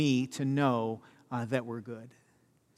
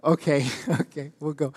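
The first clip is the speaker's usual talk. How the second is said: laughing retreat. Retold or imitated. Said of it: "And I'll be like".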